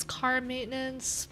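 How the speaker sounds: ordinary talk, nothing marked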